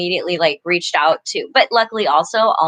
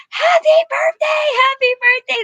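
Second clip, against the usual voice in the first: put-on much higher, loud kids' shout; kids' birthday cheer; 4 dB louder than usual. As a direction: squeal it like excited little kids. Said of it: "And the kids are like".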